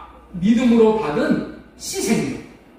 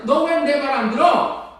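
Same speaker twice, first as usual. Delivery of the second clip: higher, raised mother's scolding; mock thundering rebuke; 7 semitones higher than usual. Mother thundering at her son for not listening. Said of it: "이렇게 호통을 쳤다는 거예요".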